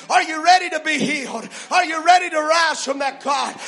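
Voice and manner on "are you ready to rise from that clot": normal talking voice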